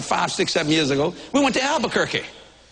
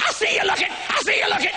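jealous wife accusing; 11 semitones higher than usual. Retold or imitated. Wife catches preacher eyeing someone, accuses him in a high shout. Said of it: "I said, "What's your problem?".

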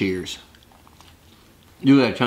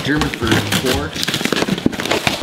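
drawn-out punchline; 2 semitones higher, much slower than usual. Pretend.

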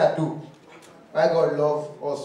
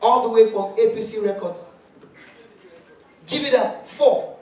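loud, higher hype-man announcement; mock announcer; 5 semitones higher, 8 dB louder than usual. Comic imitating a show host introducing Buhari, loud mock announcer. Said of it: "They come say".

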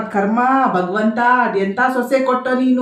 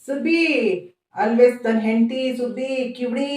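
normal talking voice

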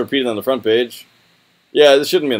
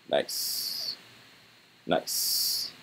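whispered; 7 semitones lower, 13 dB quieter than usual. Real, not acted. Quiet reaction to tank tops.

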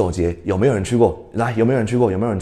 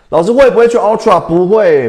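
loud reply; 7 semitones higher, 7 dB louder, faster than usual. Real, not acted. Teacher answers student loudly.

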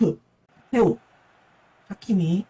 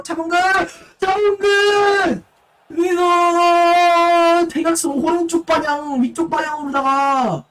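esports caster; 8 semitones higher, 4 dB louder, slower than usual. Acted. Attack call in live commentary.

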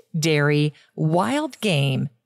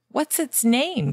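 higher, excited botanist question; eager cry; 8 semitones higher than usual. A narrator's reading. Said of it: "cried the botanist".

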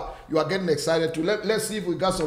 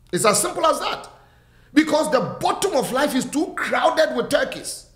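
rising and falling preacher's cadence; fervent preaching; 6 semitones higher than usual. Acted. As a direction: preach it to the whole church with conviction. Preaching resumes after greetings.

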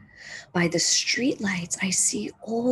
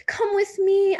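much higher and louder eager plea; begging; 12 semitones higher, 6 dB louder than usual. Acted. Marcy begging Old Man Hammer to come, eager high plea. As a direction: pull him along, pleading. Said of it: "I beg".

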